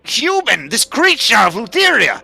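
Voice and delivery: high, loud, urgent accusation; Nolan pleading. Acted. Nolan accuses the human to Balatro, high and loud. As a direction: point the finger and plead your case, voice way up. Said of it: "And Nolan says".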